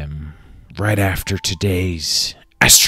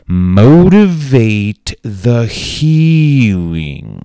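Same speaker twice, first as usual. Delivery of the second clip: slow grand delivery; 2 semitones higher, 6 dB louder, much slower than usual. Acted. Drawn out grand phrase.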